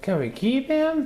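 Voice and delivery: put-on high pleading little girl voice; pleading child. A narrator's reading. Sister begging to keep the dinosaur, high and pleading. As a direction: beg in a small high voice. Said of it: "pleaded Scotty's sister".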